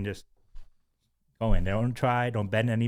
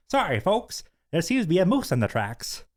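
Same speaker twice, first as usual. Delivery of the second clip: higher pitched Canadian announcer; mock Canadian accent; 8 semitones higher than usual. The speakers announcing a delay in a Canadian accent. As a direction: butcher a Canadian park ranger announcement. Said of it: "in a Canadian accent".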